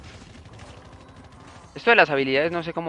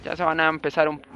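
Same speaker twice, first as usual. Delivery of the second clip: ordinary delivery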